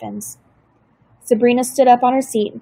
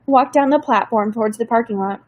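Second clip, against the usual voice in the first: plain reading voice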